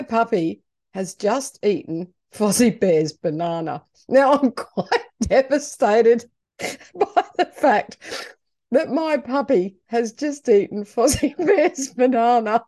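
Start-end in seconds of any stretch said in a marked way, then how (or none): 4.1-12.6 laughing. Real, not acted. Owner laughing at the puppy eating the banana, giggling. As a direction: giggle while telling it.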